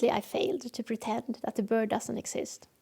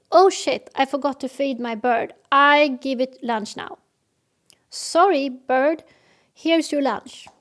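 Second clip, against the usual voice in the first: rational reaction; 3 semitones higher, 7 dB louder than usual. Shown as a demonstration. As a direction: shrug off the slip and fix it at once. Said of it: "the rational way of behaving".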